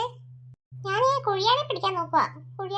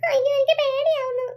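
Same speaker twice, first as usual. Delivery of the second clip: higher, frightened whimpering plea; a boy scared of his changed sister; scared little boy; 7 semitones higher, 5 dB louder than usual. Acted. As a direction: beg to go home.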